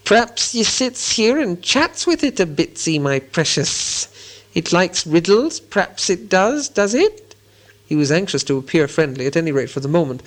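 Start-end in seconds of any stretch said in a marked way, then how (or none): 0.0-7.4 high, slow, wheedling creature voice; polite Gollum. A narrator's reading. Gollum trying to seem friendly to Bilbo, high slow wheedle. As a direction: fawn and coax, creeping upward at the end. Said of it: "became quite polite".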